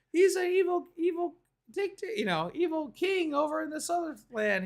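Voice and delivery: very high squeaky put-on voice; very high cartoon creature voice; fairy tale creatures. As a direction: squeak the explanation like tiny folk. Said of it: "They're like".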